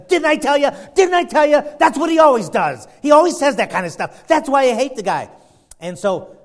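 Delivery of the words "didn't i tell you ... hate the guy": high, loud I-told-you-so tirade; worked-up king venting; acted indignant outburst. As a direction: complain at full volume, exasperated. Acted king complaining about hated prophet.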